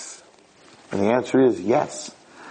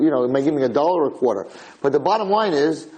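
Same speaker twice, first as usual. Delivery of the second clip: ordinary talk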